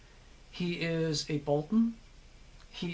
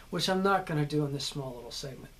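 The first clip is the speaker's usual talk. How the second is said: ordinary talk, nothing marked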